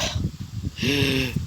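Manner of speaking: real long, weary sigh; exasperated sigh. Real, not acted. Narrator after mixing up Belle and Izuku, exasperated sigh. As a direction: sigh heavily at your own slip. Exasperated at own name slip.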